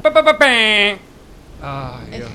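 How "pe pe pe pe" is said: loud squeaky "pe pe pe". Pretend. Imitated sound burst.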